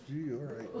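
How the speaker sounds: small, soft mock-meek reply; reluctant assent. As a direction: give in quietly and unwillingly. Retold reluctant giving in.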